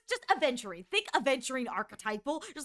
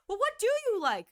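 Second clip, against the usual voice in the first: higher, louder pleading; exasperated suitor; 9 semitones higher, 4 dB louder than usual. Pretend. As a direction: plead in mock frustration. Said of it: "It's like".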